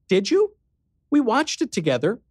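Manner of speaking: put-on high, startled girl's voice; surprised. A narrator's reading. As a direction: caught off guard, disbelieving. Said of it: "She blinked, surprised".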